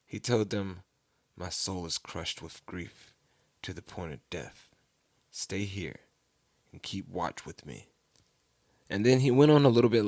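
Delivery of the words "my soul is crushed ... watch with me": hushed, weighed down with grief; deeply troubled. Retold or imitated. Jesus confides in his disciples, troubled and hushed. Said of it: "he became deeply troubled and distressed".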